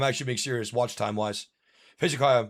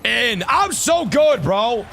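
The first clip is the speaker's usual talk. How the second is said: hyped brag; 6 semitones higher, 4 dB louder, much slower than usual. Real, not acted. Hyped after a good play.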